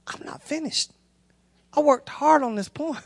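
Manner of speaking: small, high, hushed whimper; hammed-up wounded little voice; mock tearful protest. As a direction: protest softly as if still choked up. Mock protest after crying together.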